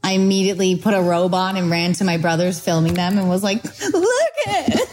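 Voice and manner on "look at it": high, slow, giggling show-off; laughing, slowed. Retold or imitated. Showing her brothers the test, high and laughing slowly. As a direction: drag it out through laughter.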